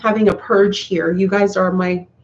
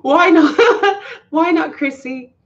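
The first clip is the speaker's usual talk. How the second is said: laughing question; 9 semitones higher, slower than usual. Real, not acted. Teasing Chrissy about her resolutions, laughing high. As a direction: ask it through the laughter.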